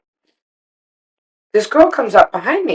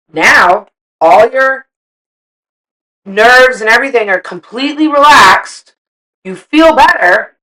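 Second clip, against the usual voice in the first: louder, higher, building to a near shout; enthusiastic pitch; 5 semitones higher, 7 dB louder, slower than usual. Retold or imitated. Urging an angry wife to try her advice, building excitement. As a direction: sell the relief, slow then loud.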